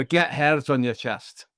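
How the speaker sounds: slow, drawn-out mock Scottish accent; slow put-on Scottish parental voice; imagined Scottish parent. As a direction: play a Scottish parent urging a child on. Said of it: "is that how your parents sounded?".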